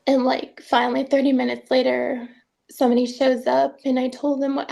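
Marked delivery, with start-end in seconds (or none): none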